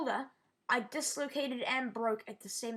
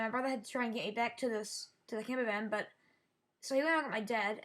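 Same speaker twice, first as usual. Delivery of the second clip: normal talking voice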